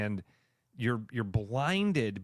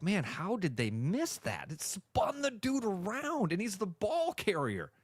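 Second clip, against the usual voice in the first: mildly hammed-up disbelief, climbing high; incredulous fan; 6 semitones higher than usual. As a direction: imitate a fan protesting a missed call. Mimics fan reacting to missed call.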